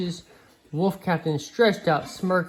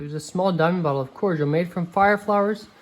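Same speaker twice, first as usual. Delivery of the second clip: ordinary delivery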